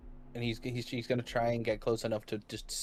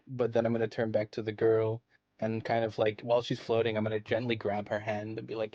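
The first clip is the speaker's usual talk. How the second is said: normal talking voice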